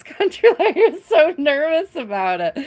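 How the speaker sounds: high, loud laughing tease; giggling through the words. Real, not acted. Laughing, teasing about nervousness.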